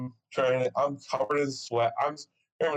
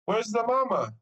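imitating the cleaning lady; 10 semitones higher than usual. Cleaning lady repeating her question to the dad, higher imitated voice. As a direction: mimic her with more emphasis, pitched up. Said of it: "she doesn't speak very good English".

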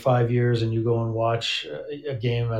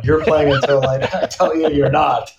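laughing through it; 3 semitones higher, 4 dB louder than usual. Retold or imitated. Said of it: "And I was like".

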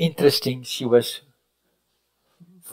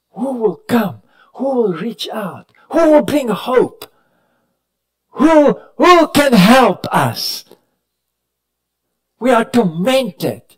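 high, loud, drawn-out pleas for help; anguished crying out; 10 semitones higher, 5 dB louder, slower than usual. Acted. Preacher voicing tormented people who call out for help, anguished and high. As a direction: cry out like people who have lost all hope.

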